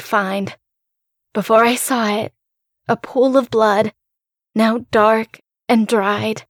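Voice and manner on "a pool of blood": ordinary delivery, nothing marked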